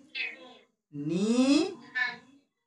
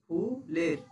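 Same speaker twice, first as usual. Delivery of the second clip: slow syllable split; 5 semitones lower, 5 dB quieter, slower than usual. Shown as a demonstration. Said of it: "আমরা কি বলছি?".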